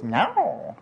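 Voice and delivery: high, drawn-out cat yowl; high-pitched mock meow; imitated cat meow. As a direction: meow like a cat whose tail is pulled. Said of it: "the cat goes".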